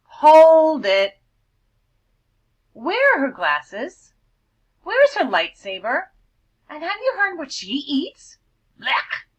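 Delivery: loud, high scornful cool-bird voice; snobby bird. Acted. Cool bird scorning the vulture, loud and high. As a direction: jeer at the newcomer like a snob.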